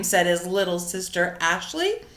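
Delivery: usual speaking voice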